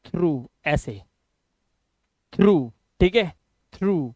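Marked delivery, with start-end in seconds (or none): 0.0-0.4 through, low and soft, long oo; correct reading. Shown as a demonstration. Teacher repeating it for learners, long oo ending. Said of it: "इसको पढ़ते हैं". 2.3-2.7 through drawn out slowly on long oo; correct reading. Shown as a demonstration. Teacher repeating it for learners, long oo ending. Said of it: "इसको पढ़ते हैं".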